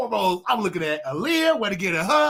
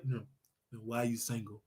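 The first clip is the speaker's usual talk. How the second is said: much lower and softer, deep put-on voice; 10 semitones lower, 14 dB quieter, faster than usual. Asking Nate why he is single, in a deep put-on voice. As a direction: drop the voice low to sound manly. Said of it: "Talk, put on my deep voice".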